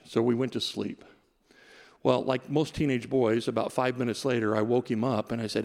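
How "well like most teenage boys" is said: normal talking voice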